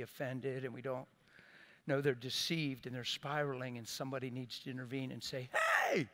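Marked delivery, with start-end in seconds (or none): 5.5-6.1 sudden high shout of Hey; calling out. Retold or imitated. Someone intervening calls out to a friend, sharp shout. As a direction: call out sharply to stop them.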